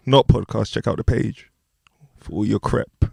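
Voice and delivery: ordinary talk, nothing marked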